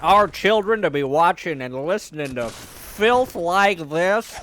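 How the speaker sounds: loud, high, indignant senator; pompous senator oratory. Pretend. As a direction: thunder like an outraged senator on the floor. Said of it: "impression of a senator".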